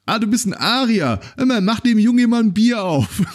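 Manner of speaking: high, loud, jovial bar regular; delighted pub regular. Retold or imitated. Pub regular welcomes the Persian and orders him a beer, high and loud. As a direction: boom it out like a tipsy regular ordering a round. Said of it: "irgendeiner von den Typen gesagt".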